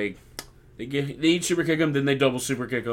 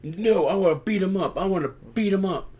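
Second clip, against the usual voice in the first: mock protest, pitched higher; lightly hammed insistence, higher voice; raging Solo; 7 semitones higher than usual. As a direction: struggle against being pulled away. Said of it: "He's like".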